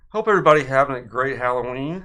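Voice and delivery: usual speaking voice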